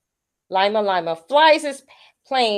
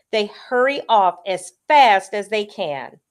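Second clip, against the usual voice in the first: ordinary delivery, nothing marked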